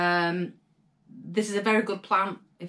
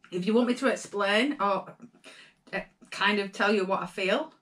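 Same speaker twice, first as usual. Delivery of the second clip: ordinary talk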